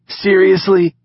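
high, loud indignant seriously; disbelief. A narrator's reading. Knox to the laughing pair, high loud disbelief.

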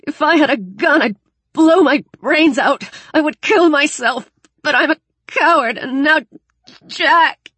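high, loud, breaking down in despair; fierce anguish. Acted. Daniel confesses his despair to Alex, fierce anguish. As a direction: pour out self-loathing at full intensity. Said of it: "he said fiercely".

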